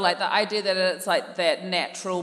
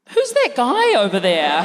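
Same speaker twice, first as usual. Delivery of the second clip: a curious mum, pitched up a little; 4 semitones higher than usual. Pretend. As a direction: Mum asks Dad about the stranger, lightly voiced. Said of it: "That's my character work".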